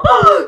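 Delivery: high mock scream; imitated scream. Vlogger acting out her own panic, mock scream. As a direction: mimic a short scream.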